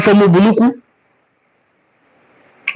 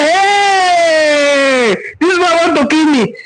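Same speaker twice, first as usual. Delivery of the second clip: alarmed parent cry; 6 semitones higher, slower than usual. Retold or imitated. Parent hears where son wants to marry, cries out in dismay. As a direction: throw up your hands and cry out in dismay.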